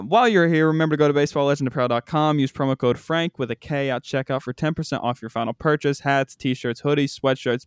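quick, upbeat promo read. Acted. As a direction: rattle off the sponsor plug.

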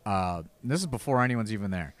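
ordinary delivery

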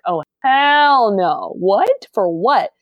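high, drawn-out outraged refusal; retold shock, stretching the words out; indignant disbelief. Retells her shocked reply to the girls.

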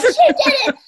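high, loud cheer through laughter; laughing cheer. Retold or imitated. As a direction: celebrate with a laugh. They're like, celebrating her.

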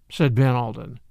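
ordinary delivery, nothing marked

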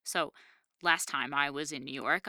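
usual speaking voice

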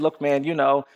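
low, reluctant mumbling for words; half-hearted, hedging lead-up to an apology; insincere stalling. Retold or imitated. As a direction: stall awkwardly, acting out a reluctant boy. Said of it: "as insincerely as I possibly could".

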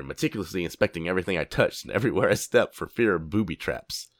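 normal talking voice

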